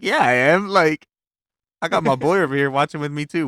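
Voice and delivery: loud, high, brash comeback to a teacher; hyped-up high-school bravado; cocky shout. Retold or imitated. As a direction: throw it back at him, loud and proud. Retold cocky answer to teacher.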